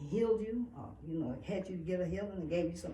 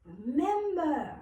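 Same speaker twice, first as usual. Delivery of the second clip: higher-pitched urging voice; ancestors' reminder; 6 semitones higher than usual. Acted. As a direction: urge them to recall. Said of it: "my ancestors came along, they said".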